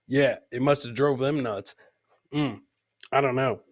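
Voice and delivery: normal talking voice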